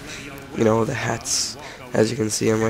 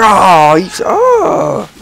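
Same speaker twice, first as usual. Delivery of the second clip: squeaky outraged yelp; 10 semitones higher, 7 dB louder, much slower than usual. Real, not acted. Killed in game.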